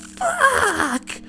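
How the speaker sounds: high mock yell, not loud; retold scream. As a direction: squeal it like a stubbed toe. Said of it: "screamed out loud".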